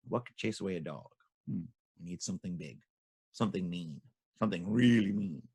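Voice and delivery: low, soft, thinking-to-himself voice; musing aloud. A narrator's reading. Advisor working out what chases dogs, hushed musing. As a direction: ponder quietly, piece by piece. Advisor ponders which animal.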